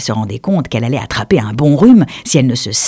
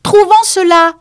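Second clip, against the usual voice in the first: high, louder, snapped at the duck; open irritation; 8 dB louder than usual. Acted. The mouse to the interrupting duck, openly irritated. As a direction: bite off the words, annoyed. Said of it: "sans chercher à cacher sa contrariété".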